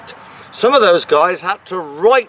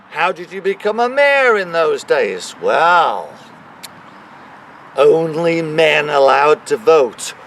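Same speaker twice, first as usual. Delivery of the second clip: ordinary delivery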